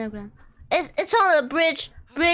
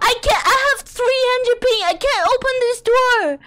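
frustrated rant; 7 semitones higher, 4 dB louder than usual. Real, not acted. Raging at the door.

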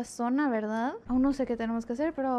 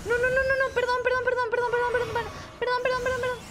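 high, rapid-fire begging; panicked apology; 8 semitones higher than usual. Real, not acted. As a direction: repeat it frantically in a high voice. Frantic apologies during attack. (disculpas agudas y atropelladas)

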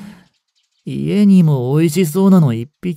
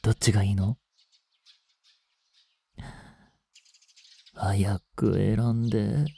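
low menacing push; 8 semitones lower, 8 dB quieter than usual. Acted. Vampire presses the frightened girl to choose, low and menacing. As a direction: lower and softer, teasing threat.

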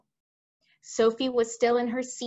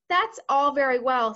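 raised, louder protest; sceptical objection; 4 semitones higher, 6 dB louder than usual. Acted. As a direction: push back on the plan. Army chief objects.

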